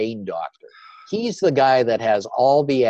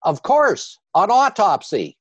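dry retort; 9 semitones higher than usual. Retold or imitated. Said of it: "And he said".

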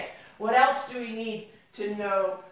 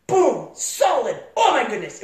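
loud, punchy exclamations; reliving the impact with excitement; thrilled amazement; 7 dB louder than usual. Real, not acted. Reliving hitting the soft shoulder.

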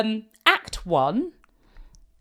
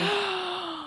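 excited gasp; 10 semitones higher, 8 dB quieter than usual. Real, not acted. Excited gasp at casting idea.

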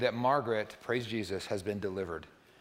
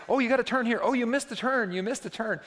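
put-on much higher, louder squeal of a fussing kid; kid backseat driver; 10 semitones higher, 6 dB louder than usual. Kids telling grandpa he missed the turn, high and loud. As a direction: shriek the warning like an alarmed little child. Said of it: "they'll say".

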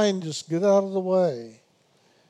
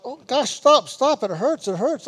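hammed-up pleading to stop hurting; mock cry of pain; 5 semitones higher than usual. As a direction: play a student begging it to stop, half-joking. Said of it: "you're gonna cry out".

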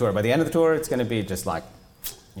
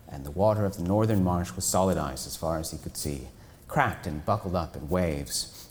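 plain reading voice

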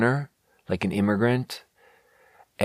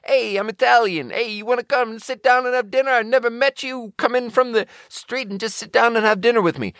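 much higher, cartoonish Italian neighbour; Italian host caricature. Italian man in a window inviting a stranger to dinner, high cartoon voice. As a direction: play the over-eager Italian calling from a window. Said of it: "just hang out of a window and be like".

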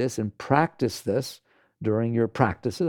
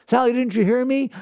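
high, loud call after a friend; hammed-up anxious call, much higher and louder; mock calling out; 12 semitones higher, 5 dB louder than usual. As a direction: call out after Sally, a bit hurt.

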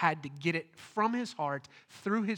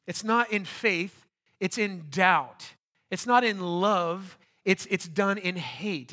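slow, louder punching of "not"; preaching emphasis; 5 dB louder, slower than usual. Acted. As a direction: drive each contrast home slowly. Preaching with building force.

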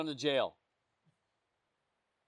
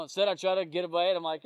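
ordinary delivery

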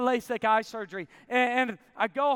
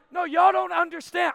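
raised, high insistent protest; yelling; 8 semitones higher, 5 dB louder than usual. Retold or imitated. Man to the whole restaurant, yelling. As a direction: holler it at a whole room. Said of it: "I'm yelling at the whole restaurant".

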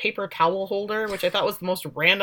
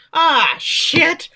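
higher mock groan of dismay; exasperated dismay; 8 semitones higher, 4 dB louder than usual. Mother learning police are coming, mock exasperated dismay. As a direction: curse as if caught out. Retold dismay at 911 call.

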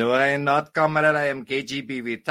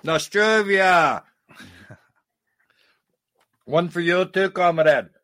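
hearty toast; 3 semitones higher, 5 dB louder than usual. Acted. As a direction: cry out a long toast in a Russian accent. Russian character makes a toast.

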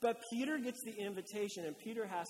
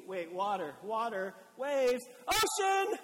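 rising panic; 7 dB louder, slower than usual. Acted. Pastor voicing Peter noticing the waves, building to loud panic. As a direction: let the fear build word by word.

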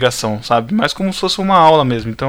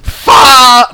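high, loud cracked voice; voice cracking; 9 semitones higher, 8 dB louder than usual. Real, not acted. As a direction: voice breaks mid-word. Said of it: "Minha voz falhou total agora".

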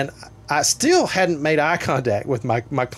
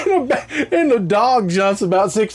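laughing; 10 semitones higher, 3 dB louder than usual. Real, not acted. Laughing while telling.

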